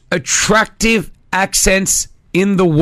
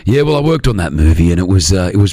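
very deep, manly Aussie star voice; Chris Hemsworth; 11 semitones lower than usual. Acted. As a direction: drop right down into an Australian leading man. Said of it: "That's what he does".

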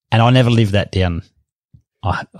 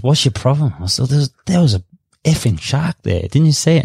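higher, urgent, worked-up pleading; agitated retold protest; 6 semitones higher than usual. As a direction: insist in alarm, voice climbing. Panicked retelling of shark alarm.

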